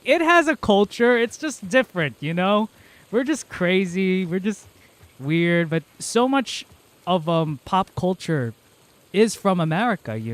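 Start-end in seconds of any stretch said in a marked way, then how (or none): 0.0-5.7 high, louder mock protest; indignant rebuttal, lightly acted; defensive retort. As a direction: push back, pitched up and insistent. Rebutting people who say America lacks culture.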